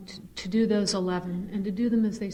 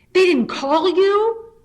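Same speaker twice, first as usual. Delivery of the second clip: much higher, louder, slow shocked question; alarmed disbelief; 10 semitones higher, 12 dB louder, much slower than usual. Retold or imitated. Mother retelling sister's shocked question on phone, alarmed and slow. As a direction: stretch it out in horror.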